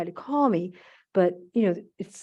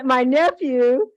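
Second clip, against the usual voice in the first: ordinary delivery, nothing marked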